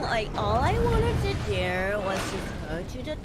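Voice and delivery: put-on Valley girl complaint; exaggerated Valley girl voice. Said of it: "what did I say about the Valley Girl".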